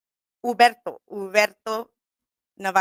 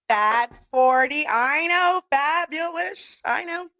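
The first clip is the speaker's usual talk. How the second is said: sassy hype; 6 semitones higher, much slower than usual. Real, not acted. Hyping her show title.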